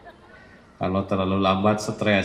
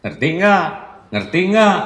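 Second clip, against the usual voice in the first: loud, higher, belittling "Ngerti nggak?"; condescending tone; 6 semitones higher, 5 dB louder than usual. Shown as a demonstration. Demo of arrogant speech to a listener, condescending. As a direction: talk down, sharp and superior. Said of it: "nadanya itu merendahkan".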